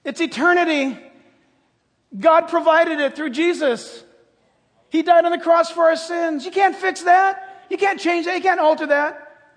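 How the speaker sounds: loud, high fervent preaching. Acted. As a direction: preach it with full force. Preaching at full force.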